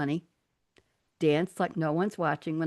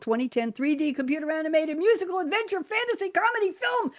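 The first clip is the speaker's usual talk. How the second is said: mock-breathless list; 9 semitones higher than usual. Real, not acted. Host reading an overlong film genre list, climbing higher.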